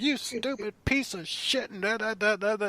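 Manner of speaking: high mock rant at the screen, slowed; hammed-up curse at a computer; yelling at computer. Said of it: "I started yelling at my computer".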